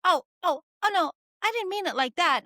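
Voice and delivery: high, loud-starting panicky backpedal; mock-whiny, high-pitched protest; mimicking flustered colleague. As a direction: imitate someone caught out, pleading. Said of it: "And she was like".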